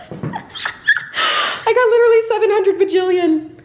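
high, slow, laughing through the words; amused laughing boast. Real, not acted. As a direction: giggle it out in disbelief. Laughing at her huge haul.

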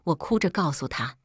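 plain reading voice

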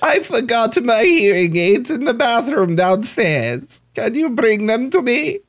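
put-on quavery-free high old lady asking for help; grandma voice. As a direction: play a deaf old grandma calling out. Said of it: "I should do a voice".

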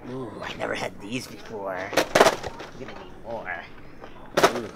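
normal talking voice

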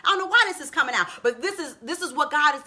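ordinary delivery

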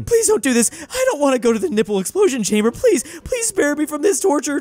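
high, loud hammed-up desperate begging; terrified pleading. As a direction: beg for your life, overacted. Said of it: "He looks scared to death and is pleading".

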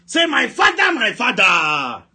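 high, loud cry stretched out and falling; shouted prayer call. Real, not acted. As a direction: bellow it for the congregation to repeat. Leading congregation in prayer call.

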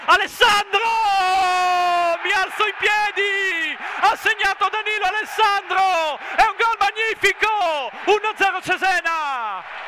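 screaming, high goal call; breathless italian goal commentary. Real, not acted. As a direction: erupt as the home side scores. Home goal scored, commentator erupts.

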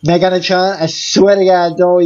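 usual speaking voice

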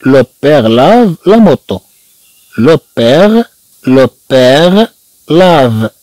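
slow, careful French; slow model reading. Shown as a demonstration. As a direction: read it out slowly for repetition. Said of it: "teacher models slow French reading".

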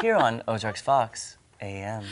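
slow, soft soothing radio voice; hushed easy-listening sign-off; Delilah. Shown as a demonstration. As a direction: stretch out the station ID, low and gentle. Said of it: "That was my soothing radio voice".

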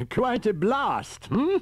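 put-on high, slow, pleased old professor. A narrator's reading. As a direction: savour the blast like a delighted scholar. Riker pleased after blast.

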